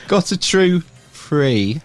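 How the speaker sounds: "true free thinker" very slowly, word by word; slow and careful. Real, not acted. Host retrying the guest's website name, slow and careful. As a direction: spell out each word at half speed. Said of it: "I can't say it once, slow".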